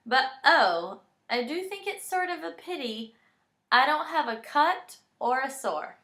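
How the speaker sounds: playful bedtime poem reading; reading a poem aloud. A narrator's reading. Reading a funny bedtime poem to Brooks, playful. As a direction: read it like a fun poem for a little kid. Reads Band-Aids poem to child.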